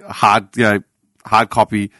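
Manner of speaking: ordinary talk, nothing marked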